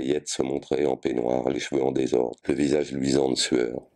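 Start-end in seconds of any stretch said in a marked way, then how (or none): none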